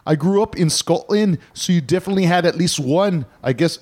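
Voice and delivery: put-on Irish brogue drifting Scottish; Irish-going-Scottish accent. Host reading listener's email, put-on Irish accent turning Scottish. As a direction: read it in a muddled Irish-Scottish lilt. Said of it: "sort of going towards Scottish there".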